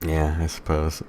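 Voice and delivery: usual speaking voice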